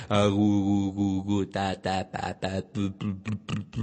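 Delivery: string of babble, gu, ta, pu, fading away; baby gurgling. Shown as a demonstration. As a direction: imitate a baby's first gurgles. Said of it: "¿Qué es el gorgotear?".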